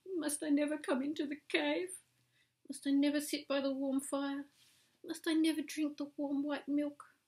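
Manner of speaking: high, pleading mock sorrow; pretend sorry. A narrator's reading. Cat pleads with the woman, high and mock sorry. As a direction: wheedle like a sulking cat. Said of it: "the cat pretended to be sorry".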